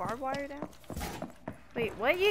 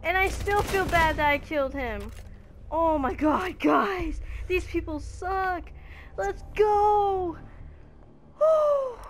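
loud, high, ecstatic yelling; real, over-the-moon celebration; victory shouting; 6 semitones higher, 7 dB louder, slower than usual. Real, not acted. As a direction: scream with joy at winning the round. Winning the round, celebrating.

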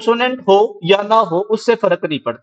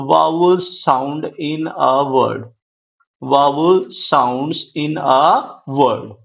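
very slow, every word of vowel sounds in a word stretched; slow dictation; 3 semitones lower, much slower than usual. Shown as a demonstration. Teacher dictating the definition for students' notes, very slow. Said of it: "teacher dictates definition".

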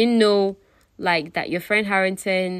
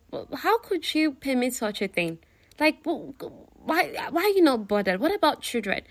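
higher-pitched, disbelieving questioning; appalled protest; 7 semitones higher than usual. Retold or imitated. James challenging his friend about the marriage, appalled. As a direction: stammer it out in high-pitched dismay. James objects to Harrington's arrangement.

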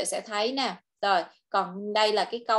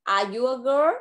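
put-on "girl" rises clearly at the end; rising question tune; 2 semitones higher, 3 dB louder, slower than usual. Shown as a demonstration. Teacher modelling the question for the child. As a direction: ask it, pitch climbing on "girl". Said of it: "con sẽ lên giọng như vậy ha".

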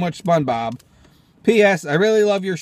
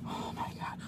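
usual speaking voice

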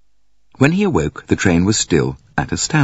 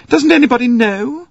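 impatient; 3 dB louder than usual. Acted. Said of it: "Her voice sounded impatient".

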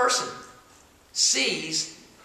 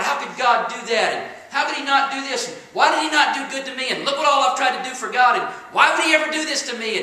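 rising, louder aggrieved complaint; indignant complaining; 7 semitones higher, 9 dB louder than usual. Retold or imitated. A churchgoer complaining to God, loud and aggrieved. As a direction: protest at God, hurt and loud. Voicing a person blaming God.

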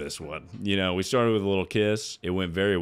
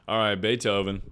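usual speaking voice

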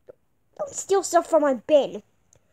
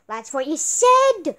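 ordinary talk, nothing marked

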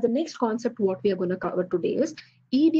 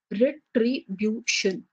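"retribution" said slowly, its four syllables apart; much slower than usual. Shown as a demonstration. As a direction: count the syllables of "retribution" as you say it. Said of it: "Four syllable means".

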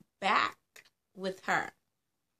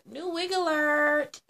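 higher, louder, slow mock announcement; announcer alert; 8 semitones higher, 6 dB louder, slower than usual. Vlogger announcing her wig to viewers, mock announcer. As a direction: proclaim it like breaking news.

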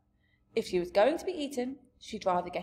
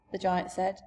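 ordinary delivery, nothing marked

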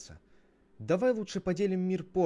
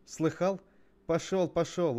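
raised, higher-pitched dismissal; bossy storybook scolding; shooing him off; 7 semitones higher, 4 dB louder than usual. Acted. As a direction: chase him away impatiently. Cain driving his brother away.

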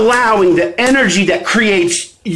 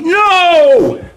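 sudden higher, drawn-out cry; emphatic no; 8 semitones higher than usual. Acted. Coach rejecting the dog as the answer, emphatic no. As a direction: knock down the joke answer.

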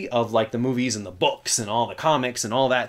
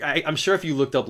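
ordinary talk

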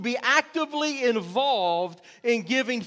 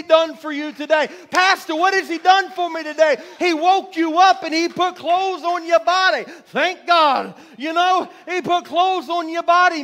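loud, raised-pitch pulpit exhortation; full-force preaching; 5 semitones higher, 7 dB louder than usual. Real, not acted. Preacher asking who is excited about God today, full-force preaching. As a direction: drive it at the congregation, building in volume.

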